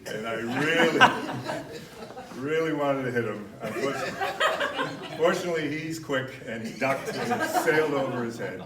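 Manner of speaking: laughing loudly while telling it; can't stop laughing mid-story. Real, not acted. Laughing while retelling the plate throw.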